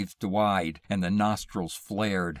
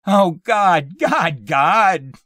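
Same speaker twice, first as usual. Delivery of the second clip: high, loud sobbing wail; anguished wail; 10 semitones higher, 12 dB louder, much slower than usual. A narrator's reading. Man in tears as wife holds him, wailing. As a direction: wail it out uncontrollably. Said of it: "he wailed".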